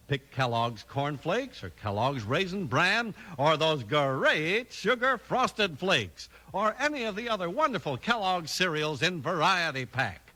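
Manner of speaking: ordinary talk, nothing marked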